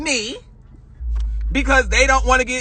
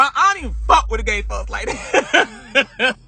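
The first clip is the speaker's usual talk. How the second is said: laughing. Real, not acted. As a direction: laugh through the line as you admit it.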